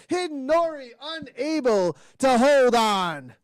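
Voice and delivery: high, loud finish commentary; excited race call. Real, not acted. Commentator calling the finish, excited high call. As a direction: keep the energy up to the line.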